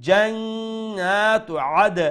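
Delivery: slow, drawn-out recitation, echoing bounce on the final "আদ"; stretched "জান্নাতু আদ" with a released, echoed d; qalqalah on dal. Shown as a demonstration. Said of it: "দাল উফরে কালকালা করবো".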